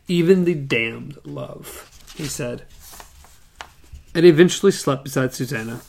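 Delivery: co-host reads Roland's lines aloud; story reading. A narrator's reading. Co-host reads the gunslinger's calm reply, story reading.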